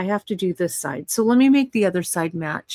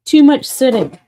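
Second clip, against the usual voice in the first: strained, louder while standing up; getting up from chair; 7 semitones higher, 11 dB louder than usual. Real, not acted. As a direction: push up out of the chair. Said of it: "Hang on, I gotta stand up".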